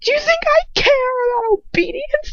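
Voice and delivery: very high, loud furious yell; furious scream. A narrator's reading. Girl screams her defiance at her captor. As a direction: keep screaming at him.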